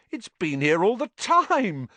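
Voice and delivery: high, loud, joyful exclamation; thrilled, high-pitched discovery; delighted. A narrator's reading. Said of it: "said Ron delightedly".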